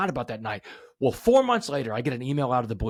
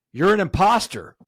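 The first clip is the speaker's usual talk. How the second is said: raised, higher and louder inner voice; mock yell of the inner critic; imposter voice; 7 semitones higher, 6 dB louder than usual. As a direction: bark it like the doubt in your head. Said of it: "The voice in my head is screaming at me".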